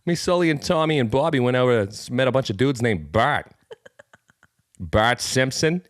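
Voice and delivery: high, loud Boston guy voice; storytelling Boston dude; Boston accent. Acted. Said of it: "things to say in a Boston accent".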